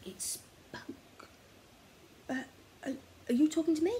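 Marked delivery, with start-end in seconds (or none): 2.3-3.9 higher, fast and stammering; a nervous boy caught off guard. Acted. Oliver answering the creature nervously. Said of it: "Oliver nervously replied".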